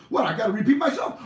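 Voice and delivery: fast, loud and high impatient shout; the dog snapping at being asked again; irritated talking dog; annoyed dog. Acted. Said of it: "And Rover says".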